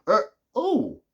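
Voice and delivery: high put-on creature voice; a startled cartoon wolf; mock wolf uh-oh. Werewolf sees the sunlight, high cartoonish uh-oh. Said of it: "The wolf goes".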